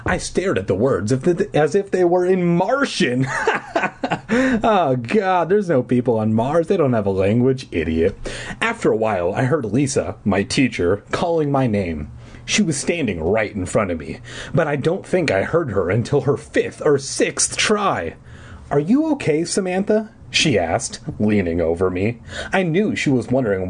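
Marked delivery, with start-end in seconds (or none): 3.3-4.6 high burst of laughter; real laugh. Real, not acted. Laughs at own joke. 18.7-20.1 lightly voiced concerned teacher.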